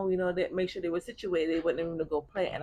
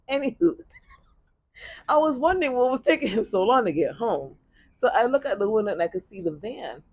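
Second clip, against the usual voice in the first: normal talking voice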